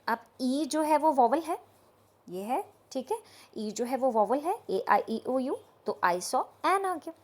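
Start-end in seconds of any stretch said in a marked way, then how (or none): none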